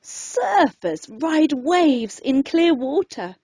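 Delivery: ordinary delivery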